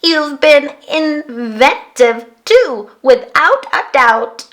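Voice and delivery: slow, deliberate robot praise; bleeping robot. Acted. Robot praising the girl, slow robot voice. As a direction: bleep it out kindly. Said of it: "The robot bleeped".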